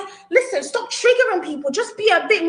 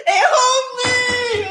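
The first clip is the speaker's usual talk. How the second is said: laughing shout; 8 semitones higher, much slower than usual. Real, not acted. Laughing in shock.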